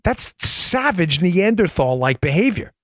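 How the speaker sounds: high-pitched, drawn-out incredulous protest; acted outrage. Objector reacting to a ban on vet care, incredulous outrage. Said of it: "You would say".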